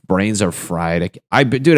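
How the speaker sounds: normal talking voice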